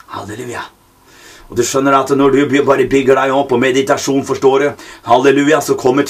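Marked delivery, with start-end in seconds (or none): 0.1-0.6 breathy whisper of praise; barely voiced, reverent whisper; whispered hallelujah. Real, not acted. Preacher whispers praise to the listener, hushed.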